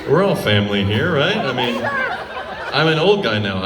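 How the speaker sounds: usual speaking voice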